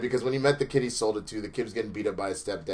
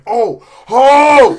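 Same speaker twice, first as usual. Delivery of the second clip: pained yelps; 13 semitones higher, 20 dB louder than usual. Retold or imitated. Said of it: "he's like".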